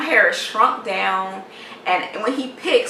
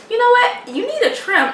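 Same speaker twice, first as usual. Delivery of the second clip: much higher, put-on lady's voice; high, louder mock advice; imitated salon lady; 14 semitones higher, 4 dB louder than usual. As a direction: mimic her in a high, pushy pitch. Imitates blow-dry lady's unwelcome advice.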